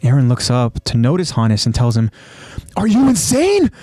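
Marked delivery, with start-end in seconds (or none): 2.8-3.7 high, incredulous cry; shocked outburst. Acted. Eren to the man shielding him, shocked outburst. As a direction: exclaim in alarm, pitch jumping up.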